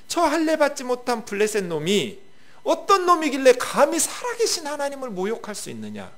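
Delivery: David defying the Philistine's insult; indignant challenge. Acted. Preacher voicing David, outraged at the insult.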